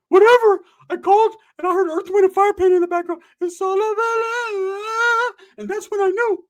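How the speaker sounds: high nagging girlfriend impression; high mock girl voice. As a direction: put on a squeaky gossiping-girl voice. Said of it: "She's like".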